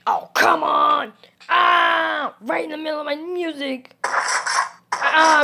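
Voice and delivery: loud exasperated outburst; angry yelling. Real, not acted. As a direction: yell in frustration at being interrupted. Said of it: "I'm so angry".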